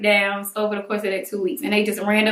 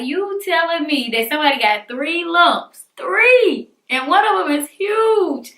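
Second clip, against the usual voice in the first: retold indignant disbelief; 6 semitones higher than usual. Patient facing two-week wait with three lumps, retold indignation. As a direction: pitch climbing high on the exclamation.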